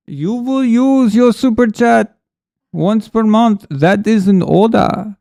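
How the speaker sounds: put-on loud, high German-accented commanding voice. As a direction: give a slow, loud order in a mock German accent. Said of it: "Nightwalker loves my German accent".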